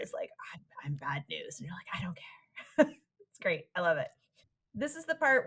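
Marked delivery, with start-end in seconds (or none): 0.5-1.6 hushed, whispered confession; low, very soft acted whisper; whispered brooding character. Voices brooding bad-news character. 1.9-2.9 slow, breath-only whisper; hushed, drawn-out acted whisper; whispered smitten reply.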